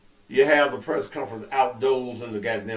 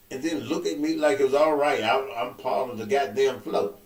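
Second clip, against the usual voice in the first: angry rant; 7 semitones higher than usual. Retold or imitated.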